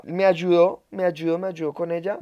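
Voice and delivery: normal talking voice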